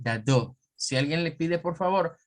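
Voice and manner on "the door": the door with no R, British accent; British accent demo. Shown as a demonstration. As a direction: say the door like a Brit, do. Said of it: "Un británico va a decir".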